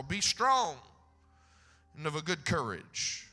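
scripture read with full preaching force; booming sermon reading. Acted. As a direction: proclaim each phrase to the church.

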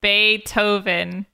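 slow Bay-toe-ven for Beethoven; drawn-out, careful Bay-toe-ven; demonstrated American pronunciation. Shown as a demonstration. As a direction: spell out Beethoven slowly, T leading the second syllable. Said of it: "how are you saying it".

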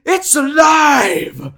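high, drawn-out mad shout; Frankenstein-style exultant yell; hammed-up movie cry. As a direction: cry it out in frenzied triumph, very slow and loud. Acting out the Frankenstein movie line.

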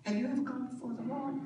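hushed, quick "Have you ever gone before the Lord?"; quick soft repeat. Shown as a demonstration. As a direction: drop the volume and hurry it. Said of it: "repeated question".

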